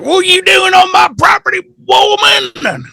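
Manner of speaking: hammed-up high-pitched hillbilly holler; mock old-coot shriek; yelling weird old man. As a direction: holler like a crazy backwoods geezer. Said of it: "he's yelling all this stuff".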